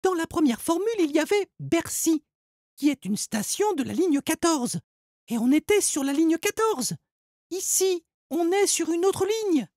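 put-on high girl's voice, calmly reasoning; clever girl explaining. A narrator's reading. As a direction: lay out the clue for him. Margot explaining.

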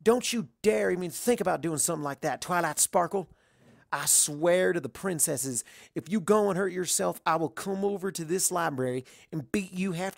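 fierce worried scolding; upset threat. A narrator's reading. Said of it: "I'd never seen her so upset".

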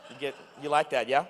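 normal talking voice

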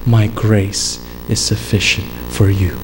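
a hint of a divine voice answering Paul; voice of God. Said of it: "God's voice came to him and said".

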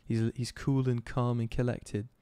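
slow, relaxed light British accent; lazy sleepy Brit; gentle British accent, slowed. Shown as a demonstration. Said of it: "like a U K guy".

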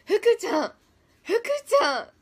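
loud, high call across the room. Real, not acted. Streamer calls out to fellow member, loud and high. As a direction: shout her nickname to get her attention.

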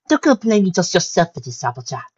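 mildly solemn. A narrator's reading. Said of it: "Rarity said solemnly".

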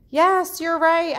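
high, loud, delighted yes; excited praise. Shown as a demonstration. Teacher praising a student's guess with high, loud excitement. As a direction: cheer the child's right answer.

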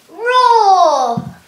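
loud lion roar. Shown as a demonstration. Teacher counting the push-ups with a loud lion roar. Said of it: "and then you go roar".

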